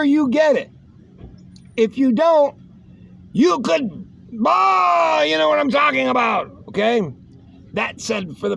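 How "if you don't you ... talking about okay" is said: higher, shouted warning; emphatic warning. Real, not acted. Warning about falling down.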